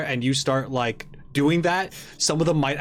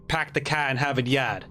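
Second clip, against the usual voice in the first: Boston "Pack the car in Harvard Yard", every R dropped; Southern Boston accent. Shown as a demonstration. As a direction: drop all the R's like a South Boston local. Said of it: "a Southern Boston accent".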